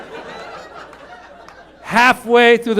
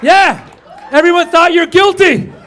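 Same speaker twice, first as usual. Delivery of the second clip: high loud punchline shout; emphatic shout; 10 semitones higher, 6 dB louder than usual. Real, not acted. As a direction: belt it out to the crowd.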